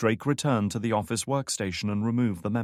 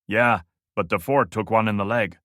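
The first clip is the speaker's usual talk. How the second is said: louder, borrowed guard's voice; imitating Lopez; 6 dB louder than usual. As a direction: pose as the guard on the radio. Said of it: "imitating the man's accent".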